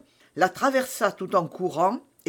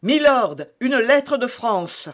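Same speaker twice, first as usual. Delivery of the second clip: high, loud call, drawn out; shouted announcement; 6 semitones higher, 5 dB louder, slower than usual. A narrator's reading. Patrick bursting in to announce a letter, shouting. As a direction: call the news across the room. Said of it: "Patrick entrait dans la salle en criant".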